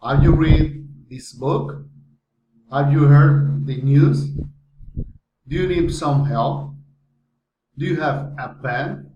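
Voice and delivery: yes-or-no questions climbing on "book", "news", "help", "pen"; rising intonation. Shown as a demonstration. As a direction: lift the last word of "Have you read this book" clearly upward. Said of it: "rising intonation".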